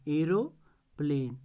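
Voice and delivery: Aeroplane slowly, aero, pause, plane; split into syllables. Shown as a demonstration. Teacher showing how the word divides in two, split into syllables. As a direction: break aeroplane in two with a clear gap. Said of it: "दो syllable में divide होता है".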